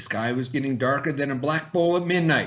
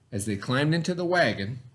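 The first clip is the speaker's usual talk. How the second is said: ordinary delivery, nothing marked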